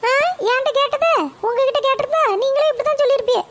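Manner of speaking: high, sharp-voiced village mother answering her mother-in-law; mother's voice. Mother answers her mother-in-law, high and curt. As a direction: pitch it up as the boy's cranky mother.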